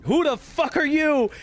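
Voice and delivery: imitated high-pitched outrage; mock-furious challenge, pitch shooting up; angry Polish guy. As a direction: act out an affronted man. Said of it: "he was so fucking angry".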